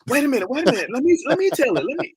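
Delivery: high-pitched excited butting in; excited interjection. Acted. Host acting out someone hit by that one chord, excited interjection. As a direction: jump in eagerly, pitch way up. Acts out excited reaction to performance.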